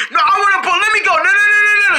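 high, loud frantic pleading; panicked protest. Acted. Patient fights to be let go, panicked protest. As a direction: struggle to break free, voice shooting up. Patient struggles to get away.

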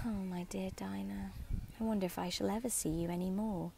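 whispered, near tears after a sigh; sigh, then whisper it to the absent cat. A narrator's reading. Alice alone missing her cat, sighing whisper. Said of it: "began to cry again".

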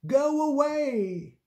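high, drawn-out storybook yell; hammed-up angry dismissal, stretched out; angry lifeguard shout. A narrator's reading. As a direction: send them off in a high, slow call. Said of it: "shouted the angry lifeguard".

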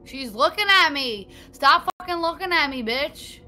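loud high frantic yell; panicked yelling. Real, not acted. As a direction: freak out at the monster. Monster staring at player.

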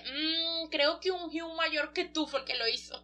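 acted timid admission; careful, reluctant reply. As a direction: break it gently, in a small high voice. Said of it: "él no quiere causar problemas".

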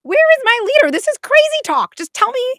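very high, loud exasperated cry; outraged passenger. Pretend. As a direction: shout for a leader in disbelief.

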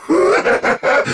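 short high villainous laugh; evil chuckle. Acted. Villain amused at enemy still struggling, mocking laugh. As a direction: burst into a brief mocking laugh.

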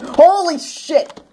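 high, slow startled cry; real fright as the creeper appears; startled yell. Real, not acted. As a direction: jump out of your skin.

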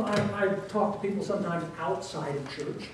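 ordinary talk

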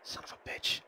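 unvoiced curse under breath; whispered curse. Real, not acted. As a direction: whisper it in frustration. Missed shot, under breath.

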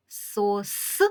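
Сос with the last с split off and hissed long; stressed final sound. Shown as a demonstration. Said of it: "закінчується слово на приголосний звук".

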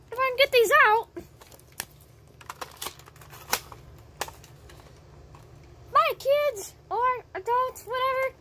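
high, bouncy cartoon dragon host; squeaky cartoon host voice; kids' show host. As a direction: greet the kids like a TV host, way up high.

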